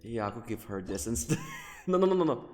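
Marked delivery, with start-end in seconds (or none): none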